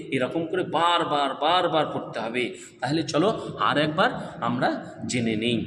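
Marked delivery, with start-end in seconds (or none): none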